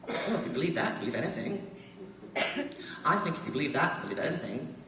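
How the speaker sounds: imitating two old British men, polite British accent, laughter breaking through; a fond send-up of two old British tourists, cracking up; posh British accent. Quoting the British men at the back of the tour train, British accent with stifled laughter. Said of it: "They talk just like that".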